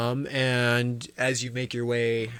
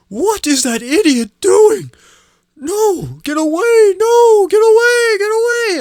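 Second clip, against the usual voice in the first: much higher, louder, frantic yelling; panicked crowd shouting; 11 dB louder, slower than usual. Retold or imitated. Townsfolk yelling at the runaway cart, panicked high shouts. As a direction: scream it as a bystander fleeing. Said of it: "you hear people kinda shouting".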